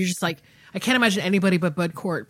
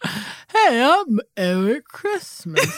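put-on slow, drunken self-introduction, pitched up; tipsy stage name; 4 semitones higher, much slower than usual. Sauced actor introducing a new stage name, slow drunken drawl. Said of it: "a little bit sauced".

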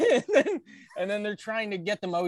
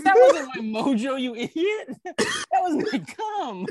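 laughing through the quote; 7 semitones higher, 4 dB louder than usual. Retold or imitated. Retold punchline, laughing through.

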